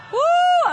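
high, loud "woo"; exuberant whoop. Real, not acted. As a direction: let out a preacher's joyful whoop. Whoop during congregational laughing exercise.

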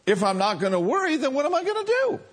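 fast, rising into a high whine; worried believer. Retold or imitated. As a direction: pitch the question up, helpless and worried.

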